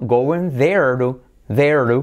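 "there to" linked slowly as ther-du; joined words. Shown as a demonstration. Teacher shows how to join, slow and linked. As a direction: draw out THERE TO as one piece. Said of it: "¿cómo lo unes?".

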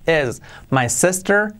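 "Es my sister" with the i of is and sister close to e; English lax i. Shown as a demonstration. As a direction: model "sister" as SES-tur. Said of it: "es una E".

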